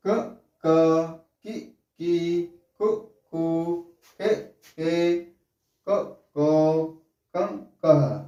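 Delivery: क, का, की, कु each said very slowly and clearly; slow syllable drill. Shown as a demonstration. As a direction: chant the barakhadi one sound at a time for pupils. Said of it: "एक बार और पढ़ते हैं". (बारहखड़ी धीरे-धीरे साफ़ उच्चारण के साथ)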